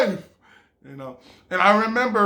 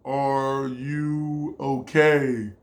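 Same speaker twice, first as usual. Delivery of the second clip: slow drawn-out repeat; 5 semitones lower, much slower than usual. Retold or imitated. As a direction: stretch it out slowly, low. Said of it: "I'll be staring at her too".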